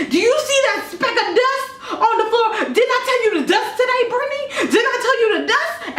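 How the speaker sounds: very high, fast, hammed-up nagging mom; shrieking mom. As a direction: shriek it like a furious neat-freak mom. Mom shrieking about dust.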